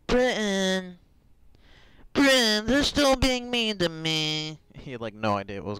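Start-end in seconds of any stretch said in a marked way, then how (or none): 0.1-1.0 high loud whiny cry of Britain!. Retold or imitated. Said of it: "the whiny voice". 2.1-4.6 high, loud whiny wail. Retold or imitated. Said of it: "the whiny voice".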